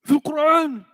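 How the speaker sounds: high, loud indignant protest; Moroccan friend protesting. Retold or imitated. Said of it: "You say".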